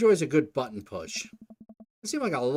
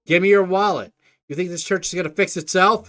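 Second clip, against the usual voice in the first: louder, harsher repeat of the order; loud demand; 7 semitones higher, 11 dB louder than usual. Acted. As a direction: bark it the second time. [name] repeats demand much louder.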